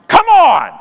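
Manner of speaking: high, loud outburst; exasperated yell. Acted. Canadian at the end of patience with flight attendants, yelling. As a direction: yell it out in protest. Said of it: "I look around and yell".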